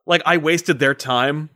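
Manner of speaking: ordinary talk, nothing marked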